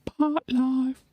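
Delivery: Park life in a put-on Cockney voice, high. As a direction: bark Park life like the record. Imitates song's voice.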